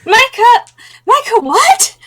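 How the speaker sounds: high, shouted disbelief; shocked shout. Real, not acted. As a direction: scream his name in shock. Shocked outburst.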